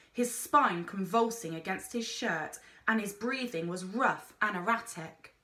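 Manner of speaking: ordinary delivery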